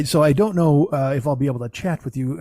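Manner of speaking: ordinary talk, nothing marked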